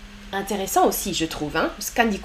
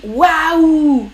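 a bright, louder gasp of wonder; surprised waouh; 3 semitones higher, 4 dB louder than usual. Shown as a demonstration. Teacher showing the chat a surprised waouh. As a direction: show how a French speaker exclaims in surprise. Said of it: "quand on est surpris".

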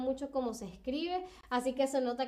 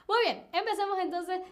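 excited; 7 semitones higher, 4 dB louder, faster than usual. Real, not acted. Starting the quiz.

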